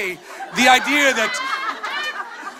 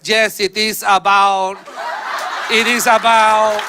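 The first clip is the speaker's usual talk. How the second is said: halting English reply; 3 dB louder, slower than usual. Retold or imitated. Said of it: "they answer me in English".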